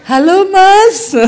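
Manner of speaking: high, slow, drawn-out hello; mock wifely phone greeting, stretched out high; wife answering phone. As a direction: coo it slowly down the line. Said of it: "Diangkat istrinya".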